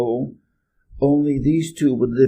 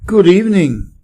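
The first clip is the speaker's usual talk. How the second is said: louder, higher greeting; polite little prince, raised voice; 4 semitones higher, 8 dB louder than usual. A narrator's reading. Little prince courteously greeting someone on Earth, raised voice. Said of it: "said the little prince courteously".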